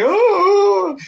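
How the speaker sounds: very high, loud yelp of surprise, imitated; mock startled oh. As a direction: squeal it like the chicks spotting the ray. Chicks startled by manta ray.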